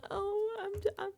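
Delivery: high, fake tearful whimper; badly faked crying, breaking off; mock choked up. Imitating Vince getting emotional with Rachel, mock choked up. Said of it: "choked up".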